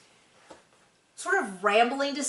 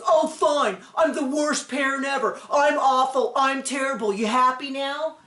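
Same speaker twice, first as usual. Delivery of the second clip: sarcastic self-pity; 4 semitones higher than usual. Retold or imitated. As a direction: whine "I'm the worst parent ever" like a sulking parent. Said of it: "And the parent goes".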